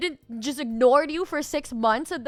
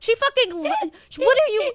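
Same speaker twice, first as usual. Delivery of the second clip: higher, louder, exasperated; exasperated outburst; 9 semitones higher, 4 dB louder than usual. Real, not acted. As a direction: burst out in disbelief. Exasperated at her partner's hesitation.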